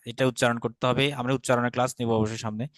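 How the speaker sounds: usual speaking voice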